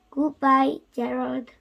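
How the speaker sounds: plain reading voice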